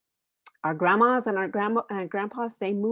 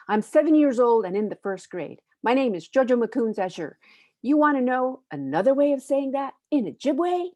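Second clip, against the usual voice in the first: put-on spunky seven-year-old, higher and louder; 5 semitones higher, 5 dB louder than usual. First grader proudly introducing herself in a read-aloud, spunky. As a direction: put some oomph into the child's lines. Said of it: "you gotta read it in that spunky voice".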